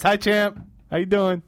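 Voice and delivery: loud and high, waving to the camera; excited greeting. Real, not acted. Greeting the player on camera, excited and loud. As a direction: call out to him with a big grin.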